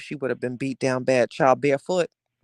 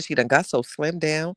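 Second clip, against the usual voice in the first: normal talking voice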